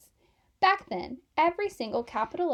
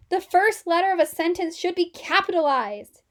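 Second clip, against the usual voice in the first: stern; 5 semitones higher, 7 dB louder than usual. A narrator's reading. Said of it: "growled Mister Wright".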